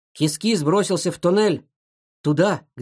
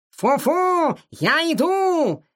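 high, drawn-out long-distance call; 11 semitones higher, much slower than usual. A narrator's reading. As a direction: holler to a friend down a tunnel. Cat calls out to his friend. (протяжно кричать другу издалека)

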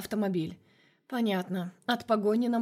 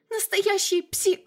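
much higher, louder, drawn-out shriek; hysterical shriek; 11 semitones higher, 6 dB louder, slower than usual. A narrator's reading. Masha in hysterics screams at Vlad, hysterical shriek. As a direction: scream it and get cut off. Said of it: "истерику".

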